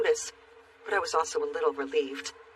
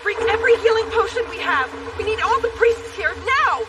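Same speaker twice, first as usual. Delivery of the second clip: urgent shout; 8 dB louder than usual. Acted. Urgent orders for healing.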